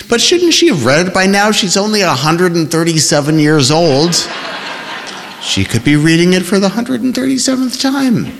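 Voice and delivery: high whiny complaint; whining. A narrator's reading. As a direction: whine like a sulky teen. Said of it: "like Paula he sounded when he whined".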